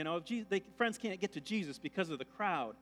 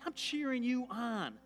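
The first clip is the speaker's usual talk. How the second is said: raised, slow cheer of a fan; mock cheering fan; 9 semitones higher, slower than usual. Fan at a game cheering the team, higher and drawn out. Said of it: "He's going like".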